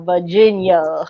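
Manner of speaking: "Virginia" as "Vah-jin-yuh", in a Virginia drawl; Virginia accent. Shown as a demonstration. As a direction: drop the r in "Virginia", old Virginia accent. Virginia said again in regional accent.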